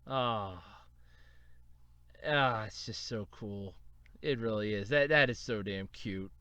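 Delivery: low, soft afterglow of gushing; spent admiration. Real, not acted. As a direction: sigh it quietly, still smitten.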